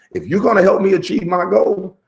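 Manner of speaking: high, loud comic begging for help; half-laughing plea to God; mock plea. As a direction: beg the heavens for help, hammed up.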